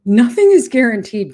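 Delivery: loud, high, insistent; emphatic. Real, not acted. Telling viewers nothing is promised, loud and insistent. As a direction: drive the point home hard. Urging viewers to seize today.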